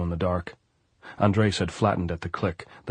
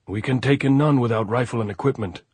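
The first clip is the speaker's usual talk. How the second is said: loud, higher sentry call; 5 semitones higher, 5 dB louder than usual. A narrator's reading. Sentry challenging Andres in the dark, loud call. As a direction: call down from behind the parapet. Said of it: "the voice said".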